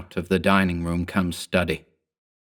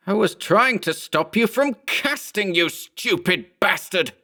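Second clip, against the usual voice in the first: higher, slowing angry cry; exasperated exclamation; 12 semitones higher than usual. A narrator's reading. Berman shouts at his clone, high and slowing. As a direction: throw it at him. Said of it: "Berman exclaimed".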